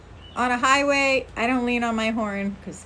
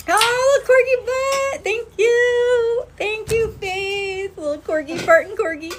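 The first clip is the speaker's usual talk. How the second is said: much higher and louder, gushing over the corgi; cutesy squeal; 11 semitones higher, 4 dB louder than usual. Real, not acted. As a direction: squeal with delight at a cute gift. Viewers send corgi gifts.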